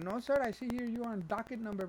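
high, insistent agent voice; scam caller impression. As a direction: contradict as a phony official. Acts out the scam woman.

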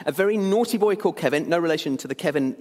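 ordinary delivery

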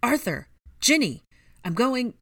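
high, loud frantic calls; panicked. A narrator's reading. Harry looks around for the missing, panicked. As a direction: shout each name urgently. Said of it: "Harry said in a panic".

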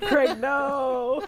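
high, loud hammed-up plea; mock anguished cry. Player mourning Craig's dropped connection, mock anguished cry. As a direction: cry out as if Craig has died.

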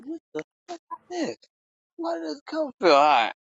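high-pitched baffled mock reaction; retold high-voiced disbelief. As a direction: relive the bewilderment in a high, exaggerated voice. Retelling his baffled nighttime reaction.

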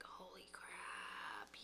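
awed hushed whisper. Retold or imitated. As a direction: breathe it out in amazement. Retold amazed reaction to technique.

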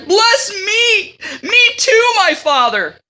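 high, loud mock wail of a grown son; bitter wailing. Esau begs his father for a blessing, mock wailing. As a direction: wail like a spoiled child, begging. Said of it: "he shouted with intensely bitter groans".

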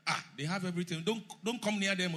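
ordinary talk

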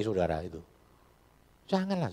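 normal talking voice

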